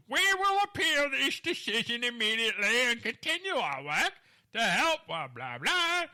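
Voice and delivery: loud, high mock lawyer voice; hammed-up creepy quote, breaking into loud blahs. Host mocking a lawyer's vow to appeal, in a creepy voice. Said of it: "I always try to use a creepy voice".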